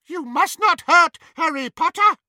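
high, loud squeaky house-elf voice. Acted. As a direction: squeak it out bravely. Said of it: "he squeaked".

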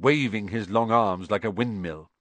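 plain reading voice

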